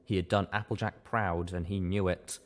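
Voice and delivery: ordinary delivery, nothing marked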